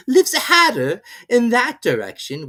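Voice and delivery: high, loud, slow teasing cat; grinning cat. A narrator's reading. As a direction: tease her sweetly. Cheshire Cat voiced.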